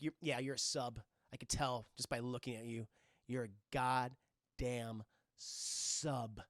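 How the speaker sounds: low, soft, knowing put-down; quiet imitation of a domineering woman; mock contempt. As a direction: size them up coolly, drawing out the last words. Blonde woman sizes up secretary.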